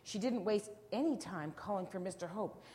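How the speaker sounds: usual speaking voice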